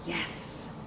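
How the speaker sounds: ordinary delivery, nothing marked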